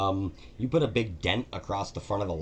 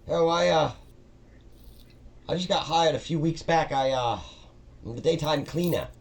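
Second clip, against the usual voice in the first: higher, meek and chatty helper voice; a nervous new employee eager to please; timid dungeon cleaner; 7 semitones higher, 5 dB louder than usual. Acted. Todd explaining his cleaning job to Maggie, high and meek.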